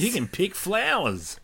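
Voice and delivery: high-pitched mock Australian accent; Aussie accent. Copying co-host's Aussie picking flowers, mock Aussie accent. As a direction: echo it back in broad Aussie. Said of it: "then an Aussie accent".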